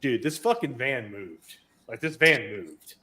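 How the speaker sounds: ordinary delivery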